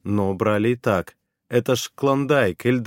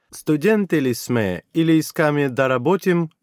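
higher, louder friendly questioning; Bulgarian woman; 5 semitones higher, 3 dB louder than usual. Acted. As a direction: ask brightly in Bulgarian. Bulgarian administrator asks questions.

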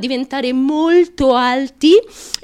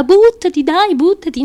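imitated cheering shout; 6 semitones higher, 6 dB louder than usual. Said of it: "ti grida".

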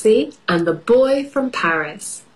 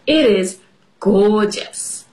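ordinary delivery, nothing marked

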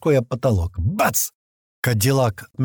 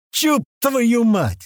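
high, very drawn-out exasperated yell; slow pitched-up swearing outburst; yelled curse; 9 semitones higher, much slower than usual. A narrator's reading. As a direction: stretch the curse out in fury. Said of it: "заорал Туман".

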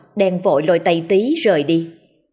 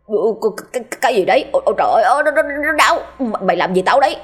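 stammering cry of pain; 3 semitones higher, faster than usual. A narrator's reading. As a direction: stutter out the panic as it stings. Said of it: "Tí ú ớ gào lên". (Tí hoảng hốt kêu đau, lắp bắp)

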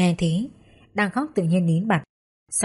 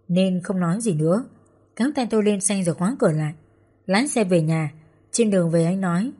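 ordinary delivery

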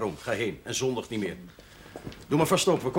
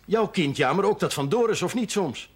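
louder, pressing reproach; stern rebuke; 5 semitones higher, 5 dB louder than usual. Acted. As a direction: push her hard on the point. Pastor presses the girl, raising his voice.

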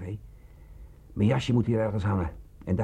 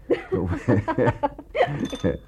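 laugh; 4 semitones higher, 3 dB louder than usual. Acted. Laughing after setting alarm.

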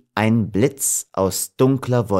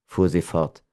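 ordinary delivery, nothing marked

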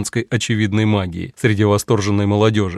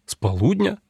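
surprised; 7 semitones higher, 4 dB quieter than usual. A narrator's reading. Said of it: "изумился я".

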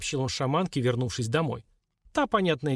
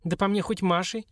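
higher-pitched, mildly peeved retort; displeased young woman, raised pitch; disgruntled grumble; 6 semitones higher than usual. A narrator's reading. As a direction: grumble back, put out. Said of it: "недовольно пробурчала Лилька".

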